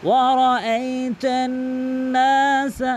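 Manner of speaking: slow chanted ওয়ারাআইতান নাসা, n hummed and long; long nasal n. Shown as a demonstration. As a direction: hold the n, stretch the a in নাসা. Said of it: "বলতে হবে".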